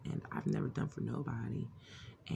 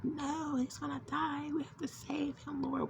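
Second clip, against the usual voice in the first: high, frantic cry that he will die; desperate high-pitched begging; frantic pleading; slower than usual. Retold or imitated. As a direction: plead in panic to save him. Frantic vision, begging to save him.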